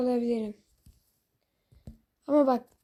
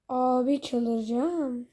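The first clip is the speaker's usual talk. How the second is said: ordinary talk, nothing marked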